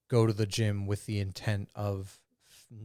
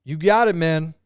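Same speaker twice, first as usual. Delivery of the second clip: mock placating reply; 7 semitones higher, 9 dB louder than usual. Placating reply in retold scene.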